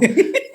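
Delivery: gargalhada aguda e curta; risada aguda. Real, not acted. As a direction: solte uma risada aguda. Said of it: "Tá todo mundo dando risada aqui". (risada aguda)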